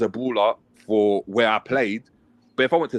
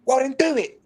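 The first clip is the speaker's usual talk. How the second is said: much higher mock coach, snapping; exasperated coach. Coach ordering player to play long, high mock snap. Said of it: "He's like".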